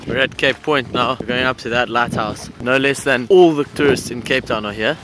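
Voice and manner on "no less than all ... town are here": ordinary delivery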